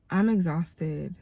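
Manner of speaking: ordinary talk, nothing marked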